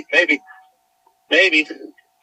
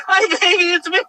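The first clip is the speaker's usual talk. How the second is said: excited dog greeting; 12 semitones higher than usual. Real, not acted. Owner greeting her found dog Cammy, excited high baby talk. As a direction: gush over the found dog.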